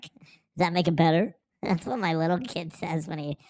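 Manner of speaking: ordinary talk, nothing marked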